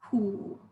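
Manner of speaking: HU dropped low; low, deep "hu"; third tone. Shown as a demonstration. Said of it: "it's the four tones".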